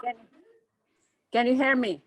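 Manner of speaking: ordinary delivery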